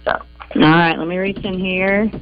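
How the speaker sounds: usual speaking voice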